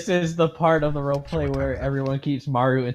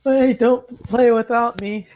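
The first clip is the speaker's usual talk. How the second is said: put-on childish whine; 7 semitones higher, slower than usual. Child character wants to play.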